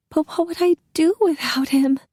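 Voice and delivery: high, desperate cry; horrified wife pleading. A narrator's reading. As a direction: clutch at the thought of losing him. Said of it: "contorted with horror".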